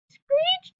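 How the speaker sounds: "screeched" squealed way up high like tires; screech imitation. Shown as a demonstration. Teacher showing onomatopoeia, squealing "screeched" up high. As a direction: make "screeched" sound like the screech it names. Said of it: "Have you noticed when you say".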